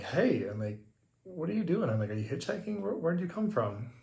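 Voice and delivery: high, loud surprised calls to a stranger; shouting across the road in surprise. Retold or imitated. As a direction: call out, startled and curious. Calling out to a stranger.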